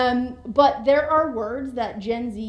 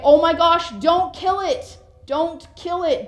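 louder, higher urgent plea to spare the bug; alarmed plea; 6 semitones higher, 6 dB louder than usual. Shown as a demonstration. Pleading for a bug's life, alarmed and loud. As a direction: beg them not to squash it. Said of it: "Or you can say".

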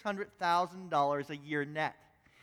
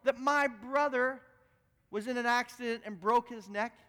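angry lawyer; 3 semitones higher than usual. Retold or imitated. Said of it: "he said again".

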